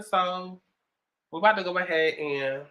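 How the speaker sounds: usual speaking voice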